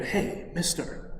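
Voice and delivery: hushed whisper from a dark alley; secretive whispered call that fades to a soft voice. Acted. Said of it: "somebody whispering to him".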